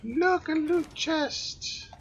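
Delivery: high, slow squeal of joy; squeaky excited voice. Acted. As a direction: gush over the chest like a cartoon. Excited put-on voice.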